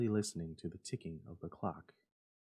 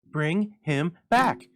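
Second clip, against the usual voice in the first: loud, slow desperate cry; 10 semitones higher, 13 dB louder, much slower than usual. Acted. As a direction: beg him loudly to bring the boy back. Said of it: "cried the old woman".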